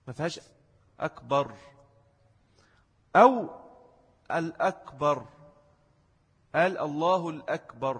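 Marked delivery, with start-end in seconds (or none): 1.0-1.5 short second vowel in أكبر; فتحة الباء القصيرة. Shown as a demonstration. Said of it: "الباء فتحة". 4.3-8.0 الأكبر with an audible L before akbar; إضافة أل. Shown as a demonstration. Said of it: "برضو مش هتنفع".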